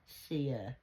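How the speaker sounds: usual speaking voice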